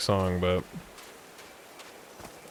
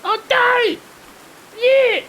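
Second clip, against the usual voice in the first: very high, slow Cockney protest; Cockney Lit impression; 3 dB louder, slower than usual. Streamer imitates Lit denying it, very high Cockney. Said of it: "my Lit impression".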